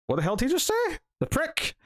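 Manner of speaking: indignant, rising to a mock yell; indignant outburst. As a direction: pitch jumping high in mock outrage. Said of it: "because I'm like".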